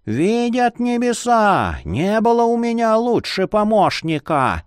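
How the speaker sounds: lightly voiced jolly old man; a creaky cheerful old scholar.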